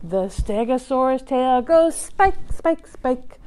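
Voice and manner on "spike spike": usual speaking voice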